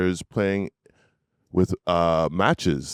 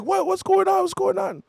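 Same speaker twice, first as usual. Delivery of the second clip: high, rattled mock questions; alarmed dad. Dad answering his yelling son, mock alarm. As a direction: imitate a father caught off guard, voice shooting up. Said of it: "And he's like".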